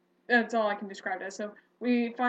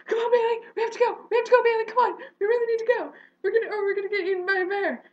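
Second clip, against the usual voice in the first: high, loud frantic pleading, laughing through it; panicked urging; 10 semitones higher, 6 dB louder, faster than usual. Retold or imitated. Friend Wesley begging Bailey to flee the bear, frantic. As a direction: hurry them along in a frantic rush. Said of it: "Wesley was just like".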